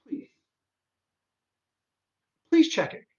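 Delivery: high, loud pleading please; exasperated plea. Real, not acted. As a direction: beg them, fed up, high and loud. Pleading with readers to proofread.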